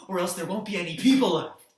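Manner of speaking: sudden very loud, fast outburst; exasperated shout. Acted. Warning that no people will be left, shouted urgently. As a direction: explode at full volume.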